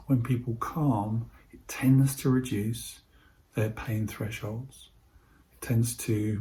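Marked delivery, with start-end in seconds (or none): none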